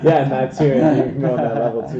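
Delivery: laughing while talking. Real, not acted. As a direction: talk through a laugh. Laughing at the door joke.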